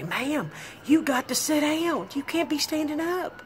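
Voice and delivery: lifeguard calling up, pitched high; mock lifeguard. Lifeguard yelling up, mimicked.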